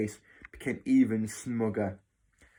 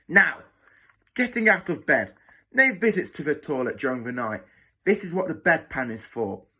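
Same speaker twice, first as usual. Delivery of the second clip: loud, higher-pitched, strict matron laying down rules; whip-crack snapping; 3 semitones higher, 5 dB louder than usual. Acted. Matron giving a new boy the ward rules, snapping. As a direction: order them about with hard, emphatic stresses. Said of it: "her words snapped like the crack of a whip".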